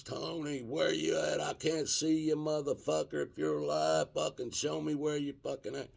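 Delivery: high-pitched reenacted yell for a lost friend; retold yelling. Searching for his crashed buddy down the mountain, high calling yell. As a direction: call out high and urgent, but keep it a retelling. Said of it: "is the top of my lungs".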